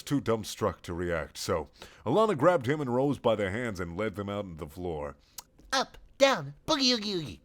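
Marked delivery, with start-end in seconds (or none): 5.7-7.4 high, bouncy dance call; excited cry. Acted. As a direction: chant it like an aerobics coach. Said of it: "cried Richard".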